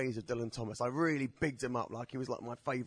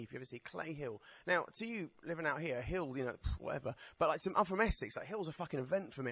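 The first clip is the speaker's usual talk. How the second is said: ordinary delivery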